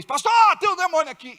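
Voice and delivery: high, loud and fast; excitedly calling the pastor over; eager call. Retold or imitated. Young believer eagerly calling the pastor about a demon, excited. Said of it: "Eu chamava o meu pastor".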